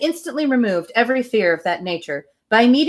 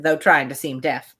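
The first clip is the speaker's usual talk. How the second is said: plain reading voice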